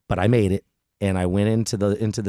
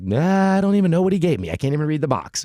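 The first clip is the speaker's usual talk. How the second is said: high-pitched mock bewilderment; acted-out clueless reply; 7 semitones higher than usual. Patient answering the pharmacist about unreadable medicine, mock clueless. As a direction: brush it off in a raised voice. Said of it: "And I'm like".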